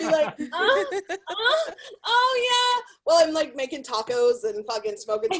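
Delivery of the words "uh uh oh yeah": faked moaning. Shown as a demonstration. Operator faking it while making tacos, hammed-up moaning. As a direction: moan it up, pitched way high. Demonstrates faked phone sex moans.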